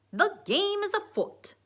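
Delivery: high, slow, loud theatrical Sherlock; Sherlock accent. A narrator's reading. Dwight announcing his investigation, high Sherlock accent. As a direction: proclaim it as a mock-British detective. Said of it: "Dwight put him in his pocket and said".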